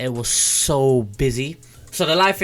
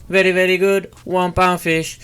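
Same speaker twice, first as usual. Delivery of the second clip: market trader call; 5 semitones higher, 6 dB louder than usual. Retold or imitated. Imitating an English market trader.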